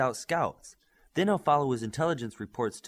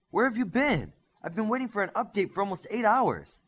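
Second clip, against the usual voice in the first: impatient demand; 9 semitones higher, 4 dB louder than usual. A narrator's reading. Impatient telepathic demand for update.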